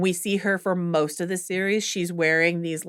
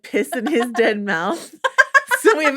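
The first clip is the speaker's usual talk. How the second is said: laughing; 6 semitones higher, 4 dB louder than usual. Real, not acted.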